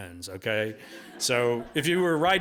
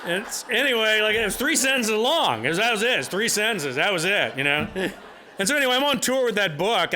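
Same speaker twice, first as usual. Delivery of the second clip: still laughing; 8 semitones higher, 4 dB louder than usual. Real, not acted. Recovering from audience laughter.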